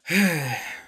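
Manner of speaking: long, weary sigh of disappointment; disappointed sigh. Real, not acted. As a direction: let out a heavy, let-down sigh. Lamenting wasted potential of film.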